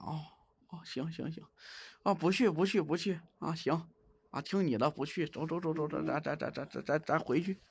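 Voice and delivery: calm her down in a hushed voice; soft soothing. Real, not acted. Streamer promising the ghost to turn back, hushed and soothing.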